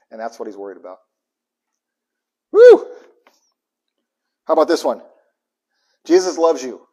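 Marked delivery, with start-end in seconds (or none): 2.5-2.9 loud high whoop; excited whoop. Real, not acted. Preacher cheering mid-sermon, a loud high whoop. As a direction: let out a joyful shout.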